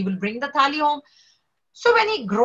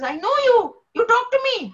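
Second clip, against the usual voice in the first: indignant protest; 11 semitones higher than usual. Retold or imitated. Namdev protesting to Vitthal, high indignant insistence. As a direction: insist he already knows God. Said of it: "So he gets angry".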